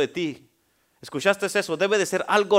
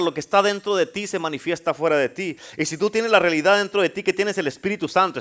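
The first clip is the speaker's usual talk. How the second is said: usual speaking voice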